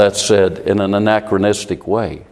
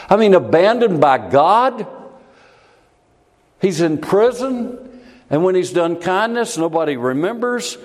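impassioned preaching; 6 semitones higher than usual. Acted. Preacher urges congregation to feel Joseph's abandonment, impassioned. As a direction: stir them with the injustice.

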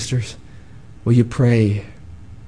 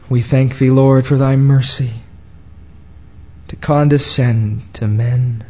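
solemn prayer; 3 semitones lower than usual. Acted. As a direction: pray humbly for the church. Closing prayer.

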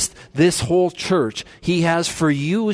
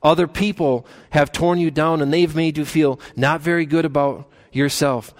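ordinary talk

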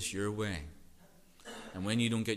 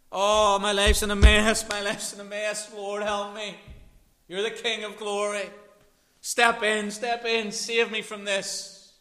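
high, loud pleading voice of a churchgoer in trouble; desperate plea; 12 semitones higher, 13 dB louder than usual. Pretend. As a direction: beg the Lord for rescue, much higher and louder.

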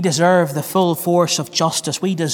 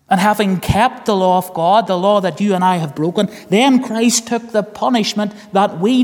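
higher, louder preaching that builds toward the end; full-force preaching; 4 semitones higher, 4 dB louder than usual. Acted. Preacher to the congregation about Christ the Saviour, full-force preaching.

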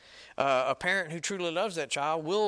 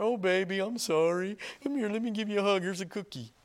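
mock coddling parent, higher-pitched voice; 5 semitones higher than usual. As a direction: fuss over a crying child, hammed-up. Soft-hearted parent comforting crying kid.

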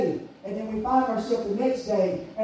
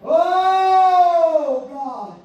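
groaning prayer, imitated; 4 semitones higher, 7 dB louder, much slower than usual. Imitating old-timers praying under a burden, groaning. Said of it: "They were groaning".

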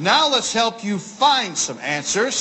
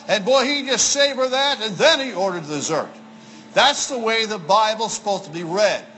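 ordinary delivery

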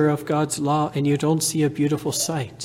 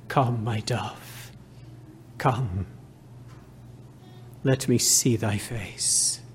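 tender call; 4 semitones lower, 4 dB quieter, slower than usual. Acted. Voicing the bridegroom's call.

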